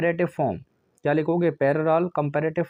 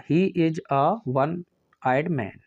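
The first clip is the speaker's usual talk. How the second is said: "a one" read slowly and evenly, word by word; slow corrected dictation; much slower than usual. Shown as a demonstration. Teacher dictating the corrected answer, slow and deliberate. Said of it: "ए".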